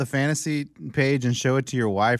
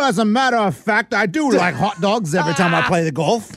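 lightly put-on English accent, a bit louder; mock English accent; 3 semitones higher, 4 dB louder than usual. Golfer showing off a fake English accent to counter girls. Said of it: "enough of the English accent".

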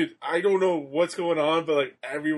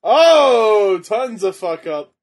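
excited loud reaction; 4 semitones higher, 10 dB louder, much slower than usual. Real, not acted. Reacting loudly to botch.

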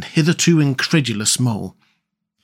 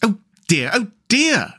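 exasperated cry, rising higher on the repeat; despairing; 8 semitones higher than usual. A narrator's reading. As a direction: despair at a friend's obtuseness. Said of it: "cried the rat in despair".

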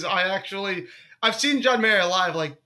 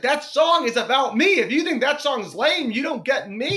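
crushed protest; 5 semitones higher than usual. Retold or imitated. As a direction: protest as if deeply hurt, hammed-up. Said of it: "I was so crushed".

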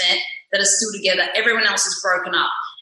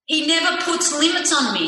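ordinary talk, nothing marked

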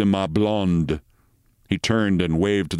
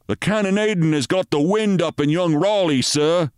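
higher, urgent sergeant voice; worried sergeant; 8 semitones higher than usual. A narrator's reading. Sergeant to Captain Mitty, high urgent report. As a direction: report the danger to your captain.

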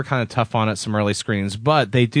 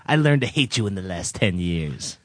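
movie quote; 6 semitones lower, 4 dB quieter than usual. Acted. Co-host performing favorite Raiders quotes, dramatic movie line. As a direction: draw out the name, then drop low. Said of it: "perform his top ten quotes".

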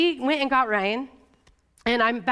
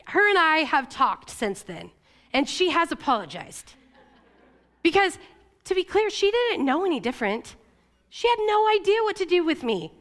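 ordinary talk

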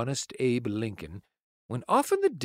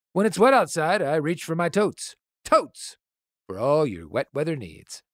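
ad read; 4 semitones higher than usual. Pretend. Unprompted Totes ad read.

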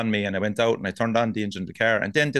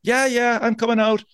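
high-pitched, slightly louder answer; groggy reply; 12 semitones higher, 4 dB louder than usual. Retold or imitated. Just woken guest shouting back to the door, high. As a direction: answer the knocking in a startled high voice. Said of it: "And I'm there".

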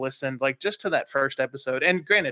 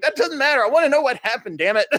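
laughing mock insistence; 11 semitones higher, 7 dB louder, faster than usual. Retold friend insisting, laughing.

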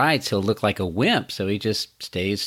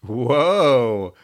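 surprised; 2 semitones higher, 4 dB louder than usual. Real, not acted. Reacting to master and slave.

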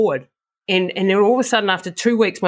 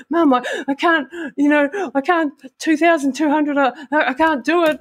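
retold sobbing plea; 9 semitones higher than usual. Runner reliving begging her mum before the race, imitating tearful panic. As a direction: replay the panicked, crying plea to mum at the start line. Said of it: "I'm bawling and I can't breathe".

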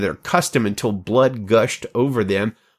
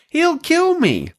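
fearful; slower than usual. Acted. Obadiah fears Elijah's errand.

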